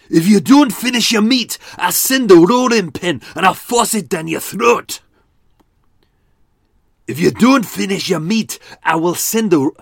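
high, shouted Glaswegian rage; Alexander Moyer. Acted. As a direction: roar threats in a harsh, guttural Glaswegian accent. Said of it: "this is my Alexander Moyer impression".

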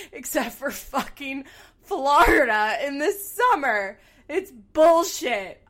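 slow, loud, drawn-out indignation; over-the-top fed-up climax of a rant; ranting outrage. Real, not acted. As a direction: hammer each word in exasperation. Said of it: "there's my little rant".

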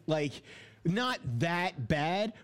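usual speaking voice

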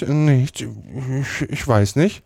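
höher, stockend; stammelnd. A narrator's reading. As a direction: stottere ertappt. Tom stammelt ertappt. (Tom stottert ertappt, höher)

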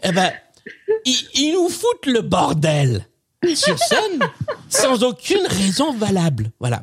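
put-on a cranky old grandpa, half laughing; very old man. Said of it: "C'est mieux avec la voix".

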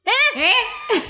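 very high, squeaky query; horrible "huh" habit. Shown as a demonstration. Showing off their own horrible habit, high squeaked query. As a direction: squeak it like someone straining to hear. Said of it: "I do this horrible thing".

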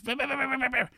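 high, breathy yips like a tiny dog; quick high yapping barks; yappy little dog bark. Retold or imitated. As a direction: imitate a small dog yapping away. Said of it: "And he goes".

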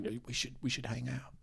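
softly spoken British impression; hushed, hesitant British star; Jason Statham. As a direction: suggest it quietly, close to the mic. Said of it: "whisper it a little bit".

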